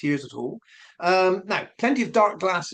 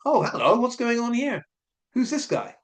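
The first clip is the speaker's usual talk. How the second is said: higher-pitched, playful bafflement; mock surprise; 6 semitones higher than usual. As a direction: act out listeners taken aback by an unexpected accent. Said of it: "we're all going".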